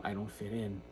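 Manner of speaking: usual speaking voice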